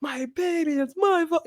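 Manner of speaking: high, slow, panicked gasp of a mother; retold panic. Mom recalling her baby falling off the bed, in a panicked high voice. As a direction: relive the guilty panic in a high voice. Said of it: "I'm like".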